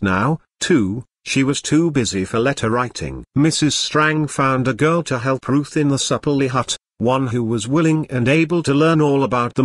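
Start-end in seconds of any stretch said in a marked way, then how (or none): none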